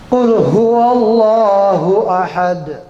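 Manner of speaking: slow chanted Quran verse; slow recitation. Shown as a demonstration. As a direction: chant it slowly. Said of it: "Itu ayat, ayat pertama".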